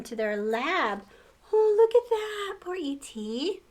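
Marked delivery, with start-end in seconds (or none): none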